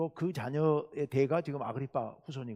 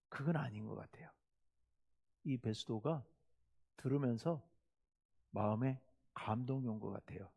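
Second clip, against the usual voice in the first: whisper; 6 semitones lower, 12 dB quieter than usual. Real, not acted. Preacher drops to confiding aside.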